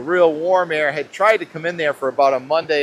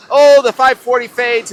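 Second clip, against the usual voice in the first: sudden burst, higher and much louder; an excited gasp of discovery; 9 semitones higher, 10 dB louder than usual. Real, not acted. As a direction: blurt it out, thrilled by the map. Forecaster surprised by model run.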